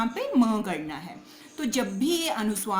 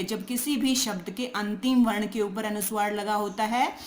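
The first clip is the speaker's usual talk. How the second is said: ordinary talk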